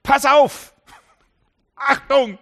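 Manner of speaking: loud, high alarm cry of warning; Jesus crying out a loud warning; urgent warning shout. Acted. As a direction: shout it like a sudden danger call. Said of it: "Und Jesus sagt".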